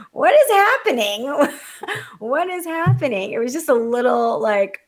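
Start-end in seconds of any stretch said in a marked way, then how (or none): 0.1-3.3 high, loud mock bewilderment with a laugh; pitched-up, baffled exclamation; incredulous. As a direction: cry out in laughing disbelief. Retold bafflement at aggressive teens.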